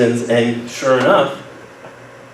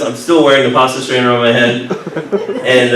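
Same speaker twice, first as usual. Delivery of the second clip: ordinary delivery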